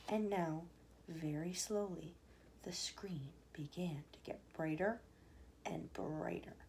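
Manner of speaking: whispered, very soft, slow; hushed whisper. A narrator's reading. Narrator hushing after the flash. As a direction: drop to a whisper for the silence.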